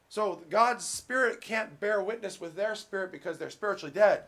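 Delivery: fervent pulpit preaching. Acted. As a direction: preach it out to the congregation. Preaching at full force.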